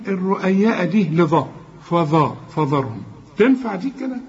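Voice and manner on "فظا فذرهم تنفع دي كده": usual speaking voice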